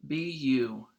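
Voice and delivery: usual speaking voice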